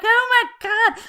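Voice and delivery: high, loud, drawn-out mock thrill; retold excitement. New YouTuber reacting to ten subscribers, retold excitement. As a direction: imitate a thrilled newbie, stretched out and high. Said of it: "I remember being excited".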